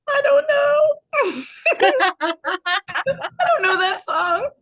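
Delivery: high, loud panicky yelp breaking into laughter; flustered panic. Retold or imitated. Asked about the song, a high panicked laughing yelp. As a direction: squeal it out like a caught kid.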